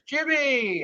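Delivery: high, cheery called-out name; bar-crowd greeting. Retold or imitated. Cheers regulars greeting him as he walks in, cheery. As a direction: hail a regular in a high singsong call. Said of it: "like I'm walking into Cheers".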